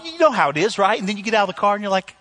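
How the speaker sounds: normal talking voice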